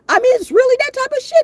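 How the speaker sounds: exclaimed. Real, not acted. Emphatic rant peak.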